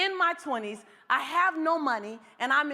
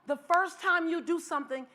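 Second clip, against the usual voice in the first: usual speaking voice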